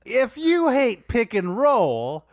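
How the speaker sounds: drawn-out comic drawl; Dwight Howard voice. Pretend. A host delivering roast jokes in a mock drawl. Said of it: "can we dial that accent up a little".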